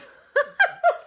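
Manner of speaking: sudden loud high laugh; high burst of laughter. Real, not acted. Laughing at robber image.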